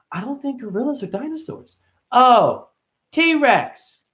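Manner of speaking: put-on high, childish back-and-forth; high kid voices. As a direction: play both mom and kid high.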